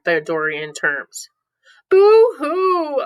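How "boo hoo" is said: hammed-up high, loud fake sob; playful fake boo-hoo cry; mock boo-hoo. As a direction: wail it like a cartoon crybaby. Acting out crying for emotion theory.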